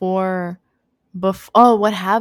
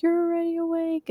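high, lightly imitated mother; retold gentle answer; 10 semitones higher, 4 dB quieter than usual. Mother answering her child, retold gentle reply. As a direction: answer a child in a raised, lilting voice.

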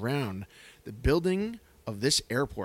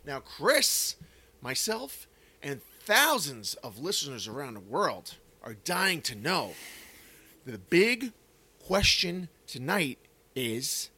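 upbeat host announcing the show; podcast host intro; 4 semitones higher than usual. Acted. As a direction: welcome the listeners like a radio host. Podcast host intro over music.

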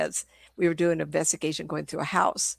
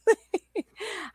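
1 high laugh; 13 semitones higher than usual. Real, not acted. Laughs mid story.

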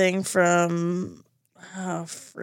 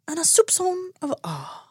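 snobby Frasier; 9 semitones higher, 5 dB quieter than usual. Retold or imitated. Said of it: "He's like".

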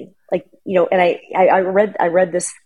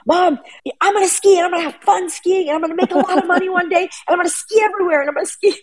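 put-on much higher, laughing shout of a thrilled teen; excited teen yelling; 13 semitones higher than usual. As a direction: yell it down the ski lift, giddy and laughing. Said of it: "Coy's yelling".

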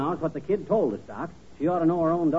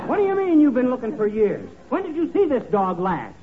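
higher and louder, thrown by the news; disbelieving outburst; startled; 7 semitones higher, 3 dB louder than usual. Acted. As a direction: caught off guard, demanding an answer. Realizing the hunt was pointless.

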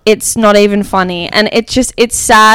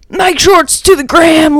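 strict coach voice; 8 semitones higher than usual. Retold or imitated. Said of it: "Lewis will come in and just be like".

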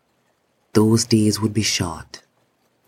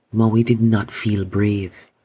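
ordinary delivery, nothing marked

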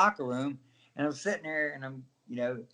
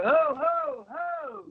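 mock war cry; 11 semitones higher, much slower than usual. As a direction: bellow it like a ring entrance. His Gilbert character bit.

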